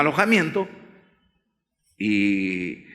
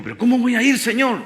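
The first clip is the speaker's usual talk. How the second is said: Moses pleading; 8 semitones higher, 3 dB louder than usual. Acted.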